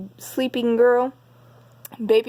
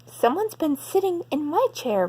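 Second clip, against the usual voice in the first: higher mother bear exclamation; exclaimed; 6 semitones higher than usual. Acted. Mommy Bear checks her chair, higher mild exclamation. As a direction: voice Mommy Bear up, a little surprised. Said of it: "Mommy Bear exclaimed".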